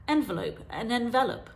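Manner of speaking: Envelope stressed on the first syllable, then envelop stressed on the second; two pronunciations. Shown as a demonstration. Teacher contrasting heteronyms for viewers, careful stress contrast. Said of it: "I'll give you an example of heteronyms".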